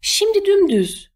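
high, drawn-out urgent call; shouted command. A narrator's reading. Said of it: "diye bağırdı". (tiz ve uzatarak bağırma)

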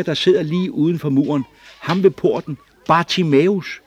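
put-on high, loud girlish voice; a little girl bursting to be believed; excited child telling her parents; young girl Rachel, eager.

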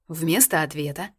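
ordinary delivery, nothing marked

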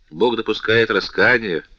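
ordinary delivery, nothing marked

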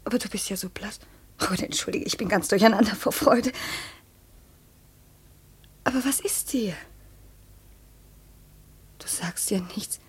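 besorgt flüsternd. Acted. Colette besorgt über Maurices Blässe.

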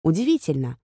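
plain reading voice

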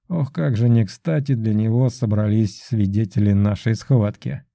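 plain reading voice

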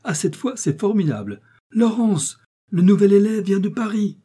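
high, loud indignant exclamation; wife clamoring to her daughter, outraged; loud outburst. A narrator's reading. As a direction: burst out to the whole house. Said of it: "clamer avec éclat".

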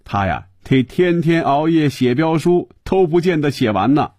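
lightly wheedling, pitch climbing; coaxing plea. Acted. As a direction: plead a favour with playful exaggeration. Coaxing a colleague on the phone.

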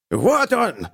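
high joyful shout; gleeful yell. A narrator's reading. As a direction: yell it out in triumph. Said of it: "радостно орал Вохровец".

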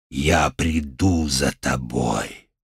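very low, slow demonic growl. Acted. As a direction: growl a threat as a dark god. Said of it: "прорычал Шами".

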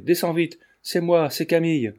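altered-voice cry. A narrator's reading. Daughter urges her father downstairs, mildly distressed cry. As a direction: call him down with anxious urgency. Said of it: "cria-t-elle d'une voix altérée".

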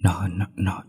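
hesitate, unable to get the sentence out; halting stammer. A narrator's reading. Young wife afraid to describe the ghost, faltering stammer. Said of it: "Ngân lại nhăn mặt ấp úng".

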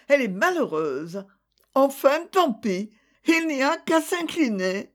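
plaintive resignation, climbing high; moaning lament.

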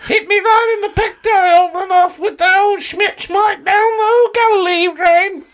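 very high put-on British old lady rattling off nonsense; mock the British comedy show's lingo. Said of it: "They're like".

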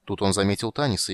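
ordinary talk

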